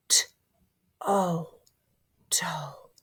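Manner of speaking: ordinary talk, nothing marked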